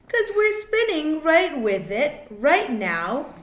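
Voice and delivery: lively, sing-song picture book reading; reading to children. A narrator's reading. Reading a rhyming space book to kids, sing-song. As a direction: bounce the rhymes for little listeners.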